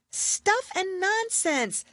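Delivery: put-on very high, slow girlish protest; indignant Alice. Alice protesting at the trial, high and indignant. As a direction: scoff like an indignant girl. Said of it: "said Alice loudly".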